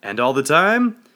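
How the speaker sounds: loud, high call, rising at the end; shouted back. A narrator's reading. Guest filled with adrenaline to congregation, shouted back. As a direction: shout it out as a rousing cue. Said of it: "I shouted back".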